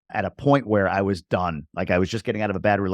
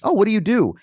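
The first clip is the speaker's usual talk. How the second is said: curious stranger; 12 semitones higher, 5 dB louder than usual. Retold or imitated. Stranger on plane quoted.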